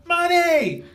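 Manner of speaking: very high, loud victory shout; triumphant yell. Pretend. Imagined celebration after winning the final, triumphant shout. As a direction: celebrate like you just got paid. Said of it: "I shoulda gotten up and just gone".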